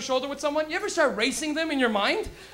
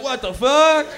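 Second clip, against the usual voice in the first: louder, a touch of shock with a laugh; startled kid; 3 semitones higher, 8 dB louder, much slower than usual. Acted. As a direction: blurt it out surprised. Kid reacting to him.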